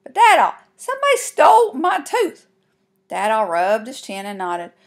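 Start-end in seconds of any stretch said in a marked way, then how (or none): 0.0-2.5 put-on high, loud kid protest; kinda shouted. A narrator's reading. Girl complaining to her dad about her stolen tooth, shouting. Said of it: "I kinda shouted".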